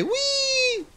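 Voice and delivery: long, high-pitched "whee"; playful squeal. Real, not acted. Player playing with the lock, playful squeal.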